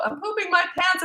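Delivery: high mock yell while running; retold scream. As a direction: squeal it out like you're laughing and panicking. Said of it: "all I was doing was screaming out".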